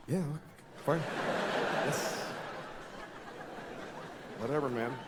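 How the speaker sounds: soft, lazy whatever; bored mock agreement; indifferent shrug. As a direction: shrug it off quietly. Public shrugging along.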